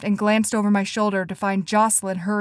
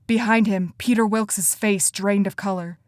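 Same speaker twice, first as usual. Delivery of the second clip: ordinary delivery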